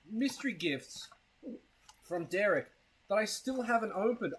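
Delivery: ordinary talk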